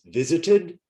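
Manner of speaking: "Visited" in three clear syllables, pitched high. Shown as a demonstration. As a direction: teach "Visited" with -ed as a third syllable. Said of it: "three syllables".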